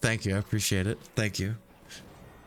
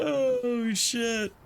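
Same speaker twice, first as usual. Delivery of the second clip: dismayed oh shit; 11 semitones higher than usual. Real, not acted. Dismay in game.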